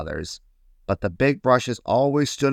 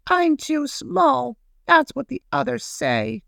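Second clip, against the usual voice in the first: very high, slow, woeful tiny voice; acted whimper of a little paintbrush; sobbing; slower than usual. A narrator's reading. Little paintbrush tells broom he is small, mock sobbing. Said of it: "sobbed the little paintbrush".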